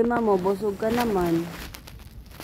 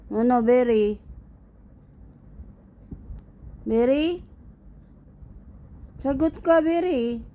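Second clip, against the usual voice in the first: rising, high-pitched calls for an answer; demanding mock scolding; 4 semitones higher than usual. Owner demands cat reply, demanding mock scolding. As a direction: press the pet sternly, pitch climbing.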